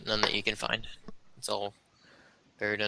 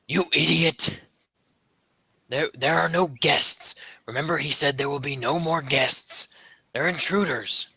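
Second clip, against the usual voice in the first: deeper goblin voice; 5 semitones higher than usual. Acted. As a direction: scold the underling like a goblin chief. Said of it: "you hear a deeper goblin voice".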